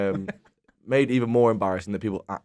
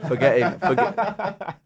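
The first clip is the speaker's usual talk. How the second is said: high, loud burst of laughter; breaking up laughing mid-word; 11 semitones higher, 7 dB louder, much slower than usual. Real, not acted. Cracking up mid-story.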